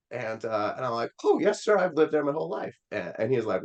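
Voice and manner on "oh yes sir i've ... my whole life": put-on much higher, overly polite young self; eager polite kid. Boy assuring the driver he knows town, high and sweet. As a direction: sell it with sugary confidence. Teen retelling answering the driver.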